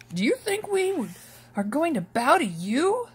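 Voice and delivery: mocking the dreamer brother; angry brothers asking. A narrator's reading. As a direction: brothers scoff at Joseph's dream. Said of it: "they asked".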